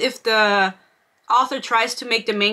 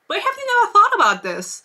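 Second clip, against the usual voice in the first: exasperated; 10 semitones higher than usual. Real, not acted. Exasperated rant at character.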